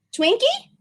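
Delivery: a bright, lifted question; high questioning offer. Acted. Shopkeeper offering the boy a robot, hopefully.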